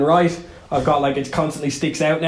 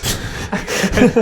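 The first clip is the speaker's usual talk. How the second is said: breathy laugh; 5 semitones higher than usual. Real, not acted. Laughs at own story.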